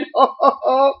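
high-pitched chuckle; chuckling. A narrator's reading. Policeman after the ducklings' surprise, chuckling. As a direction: laugh along with the pranksters. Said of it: "chuckled to himself".

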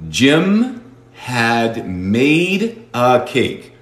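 each word apart, slow; very slow model. Shown as a demonstration. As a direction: read it syllable by syllable. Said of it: "teacher models slowly". (lento, palabra por palabra)

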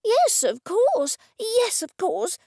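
high, thrilled agreement, starting loud; gushing. A narrator's reading. Artist accepts the gallery owner's offer, gushing. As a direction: gush it, pitched right up. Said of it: "Cressida gushed".

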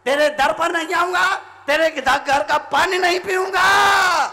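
high, loud mock oath; hammed-up quarrel shout; acted angry vow.

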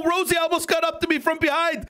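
ordinary talk, nothing marked

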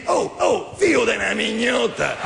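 hammed-up outburst at a driver; mock angry yell in a host's voice; Corrado swearing. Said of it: "a dirgli".

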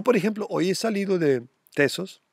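ordinary delivery

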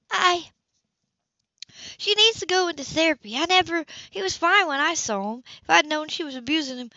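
a shaken woman defending her sister; distressed sister Sybil. A narrator's reading. Sybil voiced by narrator.

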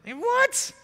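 very high, squeaked disbelief; astonished. Acted. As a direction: react with a high-pitched astonished cry. Astonished reaction to her idea.

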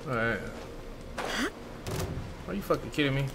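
normal talking voice

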